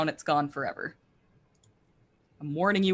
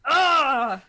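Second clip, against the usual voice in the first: loud, high effort yell; drawn-out cry behind a heavy blow; attack grunt; 6 semitones higher, 8 dB louder than usual. Acted. Player acting out a smite on the monster, attack grunt.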